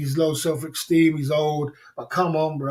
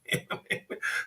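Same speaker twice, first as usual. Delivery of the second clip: silent laugh; 10 dB quieter than usual. Real, not acted. Laughs at women's tricks.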